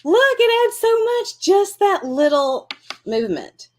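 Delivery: high, thrilled gush over the doodles; excited delight. Real, not acted. Showing viewers the finished doodles, high and excited. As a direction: squeal with delight at how it looks.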